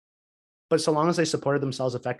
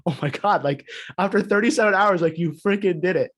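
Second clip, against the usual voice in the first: retold excited exclamation, lifted higher and louder; amazed cheer; 5 semitones higher, 4 dB louder than usual. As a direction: relive your own amazement at the birth. His own amazed reaction.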